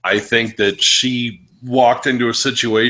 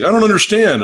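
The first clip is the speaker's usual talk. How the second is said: much higher, louder mock bewilderment; baffled friend; 10 semitones higher, 6 dB louder, faster than usual. Friend puzzled by his joke vote, imitated in mock bewilderment. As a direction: imitate a friend's loud, high-pitched confusion. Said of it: "My friend's like".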